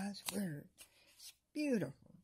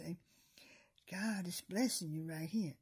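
usual speaking voice

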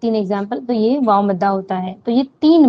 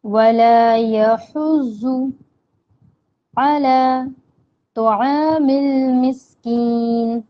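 slow, drawn-out Quranic chant; Quran recitation; much slower than usual. Shown as a demonstration. Teacher reciting the verse for students, slow chant. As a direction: recite each word slowly, holding the long vowels.

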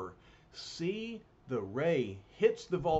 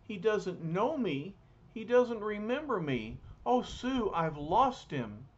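a lover's heartbreak, voice rising; distressed lament; 6 semitones higher than usual. Acted. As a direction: Alicia cries out to Sue in mild mock grief. Alicia grieves Ben's memory loss.

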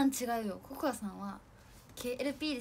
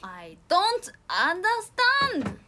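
loud, high, long-drawn exclamation; exasperated outcry; 7 semitones higher, 11 dB louder, much slower than usual. Real, not acted. Streamer stumped by English she is reading, exasperated outcry. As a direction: cry out your confusion, stretching every word. Mock despair at English sentence.

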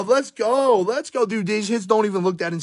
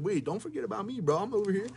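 voicing a card; 4 semitones higher, 12 dB quieter, faster than usual. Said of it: "Zach Moss said".

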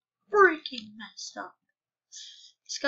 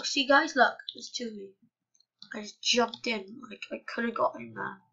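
usual speaking voice

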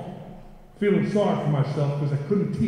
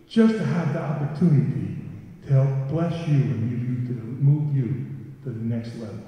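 usual speaking voice

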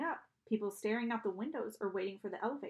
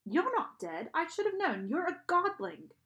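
higher, accusing British-sounding ferryman; strange British accent; 7 semitones higher, 4 dB louder than usual. A narrator's reading. Charon sees through the living boy, strange British accent. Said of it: "He had a strange accent".

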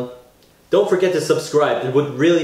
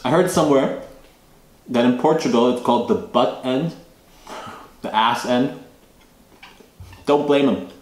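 chewing between words; talking around food; mouth partly full; 4 semitones lower than usual. Real, not acted. Seen 1: holds bread at mouth, lips pursed chewing between phrases.

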